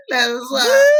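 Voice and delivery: breaking down mid-sentence, real tears; choking up for real; real crying. Real, not acted. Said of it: "I don't wanna get emotional".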